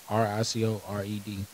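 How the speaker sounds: ordinary talk, nothing marked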